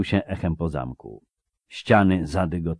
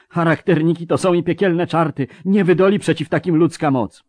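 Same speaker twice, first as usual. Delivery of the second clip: high, loud, despairing wail; moaning; 10 semitones higher, 5 dB louder than usual. Acted. Mercenary declaring devils unbeatable by men, moaning. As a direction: lament it loudly at a raised pitch. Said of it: "wyjęczał któryś z najemników".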